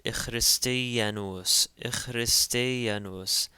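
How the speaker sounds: "ichristianos" as ikh-ris-te-a-nos; short E before consonant. Shown as a demonstration. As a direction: sound out "ichristianos" slowly with a throaty kh. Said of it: "adds a short E sound".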